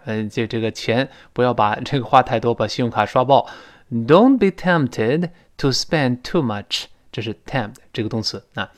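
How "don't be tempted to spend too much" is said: slow, clear teacher reading of the example; teacher reads an example sentence slowly; slow model reading. Shown as a demonstration. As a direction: read the sentence slowly for students. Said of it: "他说".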